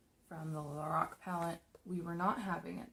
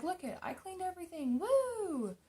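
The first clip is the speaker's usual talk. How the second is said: much higher, bright cheer ending in a squeal; excited bragging; 12 semitones higher than usual. Retold or imitated. To her mom, proud of the cleaned bathroom: excited. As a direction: show off the clean bathroom with a little whoop.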